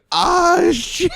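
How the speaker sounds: very high, loud retold yelp; hammed-up cry before a crash; mock panic cry. Driver retells seeing the fire truck coming, mock panicked cry.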